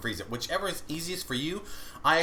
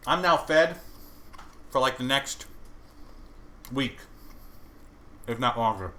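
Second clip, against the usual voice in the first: talking while chewing; mouth full; 2 semitones lower than usual. Real, not acted. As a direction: speak through a mouthful of food. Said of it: "I'm sorry, I'm chewing".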